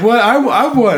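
high, loud mock protest; reenacted outraged squawk; indignant disbelief. Acting out the disputed win.

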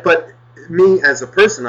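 usual speaking voice

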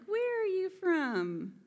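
high, drawn-out mock query; stretched, high-pitched curiosity; mock curious question. Curious locals quoted on her accent.

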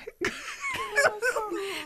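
high wheezing laugh. Real, not acted. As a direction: crack up helplessly. Host bursts out laughing.

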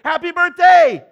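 loud, high surprise party cheer; shouted happy birthday cheer. Retold or imitated. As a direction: yell it out as she walks in.

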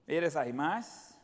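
ordinary talk

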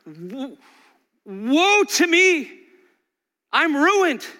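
slow, loud anguished woe; mournful cry. Retold or imitated. Pastor voicing Isaiah's lament, slow and loud. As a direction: cry out as one undone. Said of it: "the every time response".

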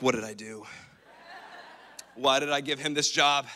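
a low rueful groan, half laughing; God in the corner regretting the job, rueful; regretful God. Retold or imitated. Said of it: "picture God in the corner like".

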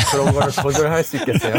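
laughing through the question. Real, not acted. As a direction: giggle while asking. Laughing about samgyeopsal. (웃으면서 말하기)

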